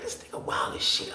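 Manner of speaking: unvoiced whisper of disbelief. Spying on roommate from the top tier, whispered. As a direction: whisper it so nobody hears.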